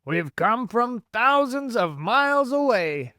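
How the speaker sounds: high, loud, drawn-out shout; shouting back. A narrator's reading. Centipede answering the police chief below, shouting back. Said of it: "the centipede shouted back".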